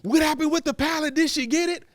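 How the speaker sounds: curious friend. Retold or imitated. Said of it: "she's like".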